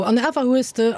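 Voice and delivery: ordinary talk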